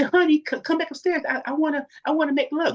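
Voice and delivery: very high coaxing wife voice; mock seductive wife; sweet wife. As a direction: lure him back upstairs sweetly. Wife calling him back.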